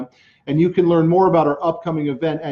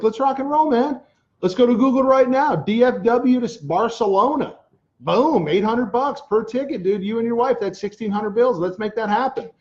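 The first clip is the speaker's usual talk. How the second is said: pitched up high, pumped retelling of his own pep talk; retold hype; 6 semitones higher than usual. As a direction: replay how you fired him up, high and eager.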